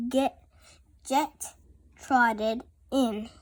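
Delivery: ordinary delivery, nothing marked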